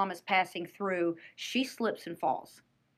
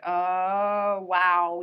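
stunned onlookers; 8 dB louder than usual. Retold or imitated. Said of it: "looking around like".

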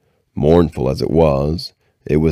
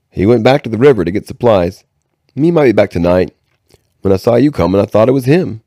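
slightly hammed boy's voice, pitched higher; a hint of a wary boy, higher; lightly voiced boy; 6 semitones higher, 4 dB louder, faster than usual. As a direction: lightly play a boy covering with a lie.

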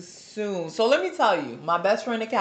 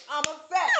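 a hint of [name], slightly higher; lightly mock-sulky [name] impression; 9 semitones higher, 4 dB quieter than usual. Joking to her friend, a light [name] impression. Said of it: "In my [name] voice".

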